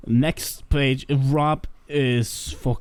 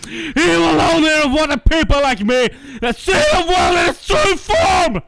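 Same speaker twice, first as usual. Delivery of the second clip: edgy villain rant; 3 semitones higher, slower than usual. Acted. Friends recording a show, reading the edgy villain's rant, menacing then shouting. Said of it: "regale us with the edgy rant".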